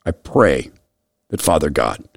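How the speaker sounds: low, soft and sincere; prayerful. Real, not acted. Host praying for a lost boy, low and soft. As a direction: pray quietly from the heart.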